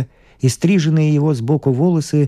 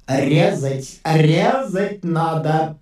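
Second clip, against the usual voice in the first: high, stretched-out demonic cry; demonic voice of fear; 4 semitones higher, much slower than usual. A narrator's reading. Fear urges the doctor to operate, in a demonic voice. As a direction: draw the words out like a menacing demon. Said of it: "Демонским голосом пел страх".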